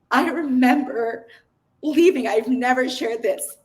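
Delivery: real emotion breaking through as she recalls it; choked-up, heartfelt recollection; getting emotional. Real, not acted. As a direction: speak close to tears, fighting to keep going. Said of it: "sorry, I'm gonna get emotional".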